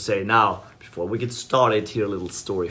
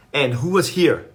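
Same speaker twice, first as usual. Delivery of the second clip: raised, louder questioning of a wife; acted confrontation; 5 semitones higher, 5 dB louder than usual. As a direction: confront them as a suspicious husband. Husband confronts wife after rushing home.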